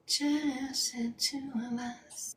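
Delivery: whispered and slow, close to the mic. Real, not acted. A secret aside to her chat, whispered. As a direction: breathe it out as a private aside.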